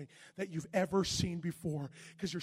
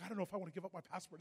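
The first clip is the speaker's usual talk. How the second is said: nervous rush; 6 dB quieter, faster than usual. Retold or imitated. Nervous inner thought.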